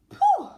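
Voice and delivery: a loud high spitting pooh; spitting sound. Acted. Anteater spitting the ticklish ant out of his mouth, loud. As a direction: spit it out hard. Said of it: "spat the ant".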